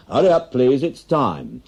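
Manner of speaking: barman's closing-time shout; pub closing call. Acted. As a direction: call last orders over the noise.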